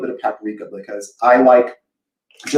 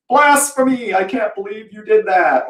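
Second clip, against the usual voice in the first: high, scandalized cry; mock outraged Southerner; 8 semitones higher than usual. Southern viewers scolding her recipe, mock outrage. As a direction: play an appalled traditionalist. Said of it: "people are gonna watch this and go".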